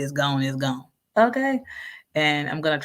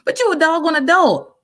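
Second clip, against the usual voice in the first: loud, much higher exasperated burst; exasperated outburst; 13 semitones higher, 7 dB louder than usual. Real, not acted. Teacher venting at whining adult students, loud exasperated. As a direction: blow up at the grown student.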